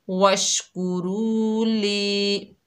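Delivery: very slow chanted वशकुरू ली, vowels drawn; Quran recitation. Shown as a demonstration. Teacher recites the joined word for students, slow chanted. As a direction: recite it slowly, stretching each long vowel. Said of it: "एक अलिफ की मिकदार खींचकर पढ़ेंगे".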